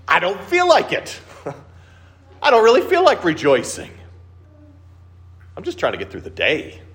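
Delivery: high, half-laughing "don't feel like it" sigh; a tired worshipper muttering back at the verse; weary reluctance. Retold or imitated. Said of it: "you think to yourself".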